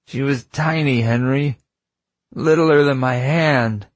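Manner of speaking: high, slow, aching grief; grief-stricken pleading. Acted. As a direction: let the loss break through. Grieving father about lost baby.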